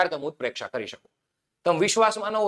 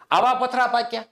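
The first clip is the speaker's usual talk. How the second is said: higher, pointed jab after the praise; sarcastic taunt; 5 semitones higher than usual. Shown as a demonstration. Teacher showing students the sting of a taunt, sarcastic. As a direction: twist the knife with a sneer. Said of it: "tone માર્યો".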